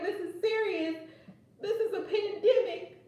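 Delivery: high-pitched indignant scolding, half laughing; mock-angry boyfriend. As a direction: imitate his outrage while amused. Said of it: "he was so mad".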